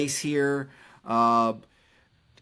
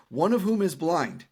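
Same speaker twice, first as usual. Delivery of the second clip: ordinary talk, nothing marked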